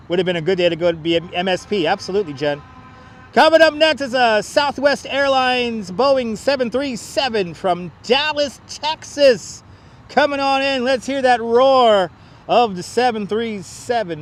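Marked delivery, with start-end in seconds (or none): 3.3-14.2 higher, drawn-out announcer call. Pretend. Spotter announcing the next Boeing landing, announcer voice. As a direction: call the plane in like a ring announcer.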